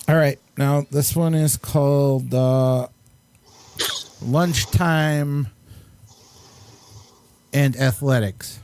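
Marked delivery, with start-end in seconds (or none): none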